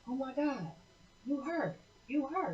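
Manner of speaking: high, thrilled gasp of recognition; excited recognition. Retold or imitated. Retold prophetess recognizing the author, high and thrilled. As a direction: gush like you've just spotted a celebrity.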